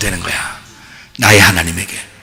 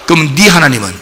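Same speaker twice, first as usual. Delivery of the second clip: ordinary delivery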